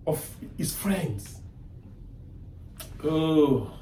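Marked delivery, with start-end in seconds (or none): none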